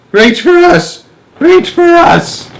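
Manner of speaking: high, loud desperate shout. Acted. Old people running after Wonka, loud high shouts. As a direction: yell after them as you run.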